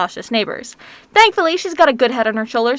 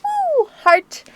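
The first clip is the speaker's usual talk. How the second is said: imitating the girl; 13 semitones higher than usual. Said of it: "she's all".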